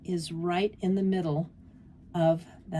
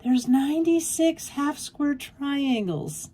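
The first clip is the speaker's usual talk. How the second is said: quoting friend; 6 semitones higher than usual. Retold or imitated. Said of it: "she said".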